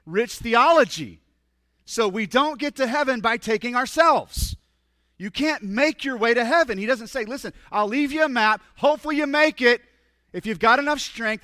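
loud, high-pitched, impassioned sermon; full-force preaching. Real, not acted. Preacher telling church Jesus takes you to heaven, full-force preaching. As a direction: drive each phrase home at full volume.

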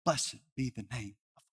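put-on soft, hushed quote in a light German accent; a touch of German accent, quiet and reverent. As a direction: quote the widow gently with a hint of German. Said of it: "in her thick accent".

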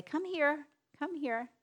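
a hint of a mother beckoning her child; lightly voiced mother calling her son home. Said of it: "I almost hear it in the voice of a mother".

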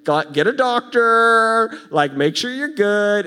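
high, loud, frantic pleading; comically panicked, stretching the words out; mock alarm. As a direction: call after someone to stop, voice way up. Acts team stopping the walking patient.

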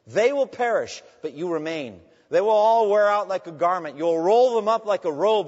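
high, loud proclamation, falling away at the end; ringing pulpit reading of scripture; declaimed scripture reading. Acted. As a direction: proclaim the verse to the whole congregation. Reads scripture out forcefully.

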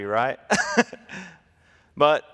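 ordinary talk, nothing marked

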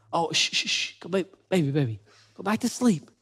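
put-on soft hushing lullaby to a baby; whispering over a crib. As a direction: shush a baby back to sleep. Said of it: "it goes something like this".

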